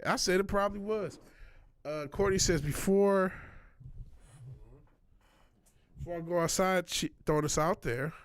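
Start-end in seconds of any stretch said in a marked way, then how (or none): 2.6-8.1 through a face mask. Real, not acted. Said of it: "trying to read through the mask".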